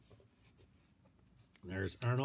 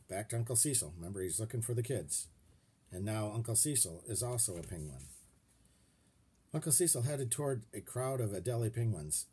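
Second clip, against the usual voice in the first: ordinary delivery, nothing marked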